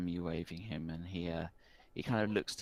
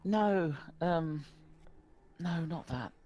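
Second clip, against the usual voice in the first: put-on higher, earnest boy's voice, a little slow; a worried village boy pleading for help; young rural boy, worried; 11 semitones higher, 4 dB louder, slower than usual. Rural boy explains his lost sister to the barkeep.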